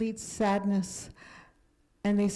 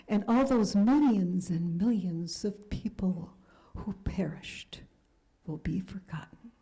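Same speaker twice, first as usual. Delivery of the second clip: grave whisper. Retold or imitated. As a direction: whisper it as a solemn warning. Elie Wiesel's grave prophecy retold.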